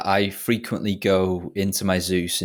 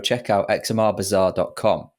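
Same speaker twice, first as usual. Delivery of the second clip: ordinary talk, nothing marked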